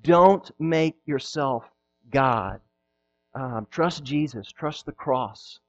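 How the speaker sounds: slow, quiet, earnest plea; soft appeal. Acted. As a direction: gently urge them to repent. Soft pleading exhortation.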